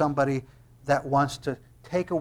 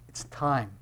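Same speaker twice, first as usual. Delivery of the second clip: understated prompting; 2 semitones lower, 6 dB quieter than usual. Acted. Brothers telling Jesus it is time, quiet prompting. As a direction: drop it in quietly. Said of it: "Jesus' brothers walk up to Jesus and they go".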